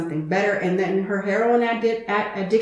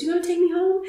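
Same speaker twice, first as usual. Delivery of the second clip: high hopeful echo; 10 semitones higher, faster than usual. Retold or imitated. Retold her reply, high.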